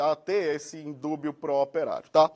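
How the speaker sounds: normal talking voice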